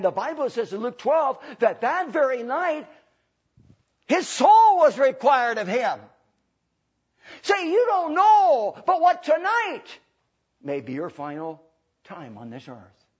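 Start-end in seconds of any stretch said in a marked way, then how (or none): 4.1-9.9 high, loud, slow proclamation; full-force preaching. Real, not acted. Preacher warns congregation about tonight, high slow shouted preaching. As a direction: stretch the words in a loud pulpit cry.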